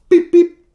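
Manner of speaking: high, squeaky scanner beeps; mock scanner beep, high; register beeps.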